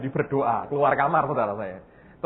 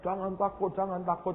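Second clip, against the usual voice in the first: much softer, hushed do-not-fear; soft reassurance; 3 semitones higher, 12 dB quieter than usual. Retold or imitated. God quietly calming the worried pastor, hushed and soft. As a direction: hush it gently, like comforting a child.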